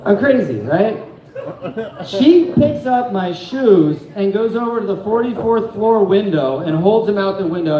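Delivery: ordinary delivery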